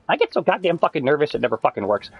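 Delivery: ordinary talk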